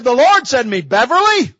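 high, drawn-up call of the name. Retold or imitated. Mother retells the Lord calling her, calling out.

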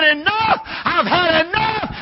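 high, shouted divine ultimatum; God's anger. Acted. God answering Pharaoh's mocking, angry shout. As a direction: thunder it as God losing patience.

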